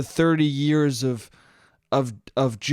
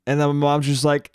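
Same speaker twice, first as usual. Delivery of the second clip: normal talking voice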